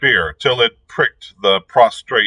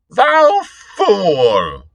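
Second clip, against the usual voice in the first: high, scornful shriek; shrieking old fiend; 12 semitones higher, 4 dB louder than usual. A narrator's reading. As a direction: screech it at the fool. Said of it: "shrieked the old fiend".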